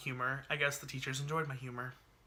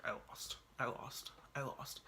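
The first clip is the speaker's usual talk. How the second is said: soft whispered repeated lament; whispered dismay; 11 dB quieter than usual. Retold or imitated. Student on the phone with his teacher, whispering in dismay. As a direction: whisper it, crushed. Phone call, hearing results.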